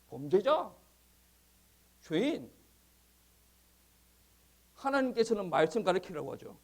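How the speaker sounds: loud, climbing sermon delivery; fervent preaching. Real, not acted. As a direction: build to a forceful pulpit cry. Preaching at full force. (힘차게 외치는 설교)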